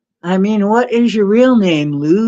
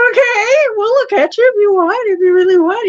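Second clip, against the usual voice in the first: high, louder mock-sympathetic coo; a fake-sweet sympathy voice, pitched high; mock pity; 12 semitones higher, 4 dB louder, faster than usual. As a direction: coo at them like a needy baby.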